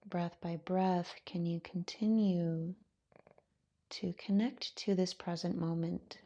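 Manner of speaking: calm, unhurried voice with long pauses; guided meditation. Acted. Yoga teacher settling students into their breath, guided meditation. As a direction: ease them gently into stillness, softening as you go.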